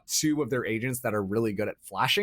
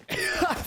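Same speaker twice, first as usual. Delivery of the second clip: nervous laugh. Real, not acted. Laughing after scary round.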